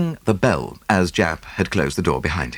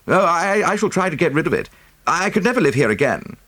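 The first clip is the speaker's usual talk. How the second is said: a hint of an uneasy gentleman, pitched higher; pitched-up young gentleman, a little shaken; lightly voiced Havering; 11 semitones higher than usual. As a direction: wants nothing more to do with the place. Havering dreads the lodge.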